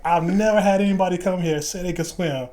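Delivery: imitated Southern voice, high and emphatic; strong Southern accent. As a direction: play an amazed Southern instructor. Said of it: "strong Southern accent or whatever".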